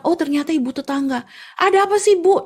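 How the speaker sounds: high, irritated question; annoyed tofu seller. Acted. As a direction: grumble at the early visitor. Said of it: "Terus dia bilang".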